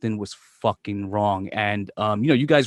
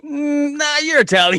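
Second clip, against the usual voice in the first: high, loud, needling sing-song voice; mock teasing; 6 dB louder than usual. Host teasing his friend about being Italian, high mocking voice. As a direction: poke fun at him in a much higher put-on voice.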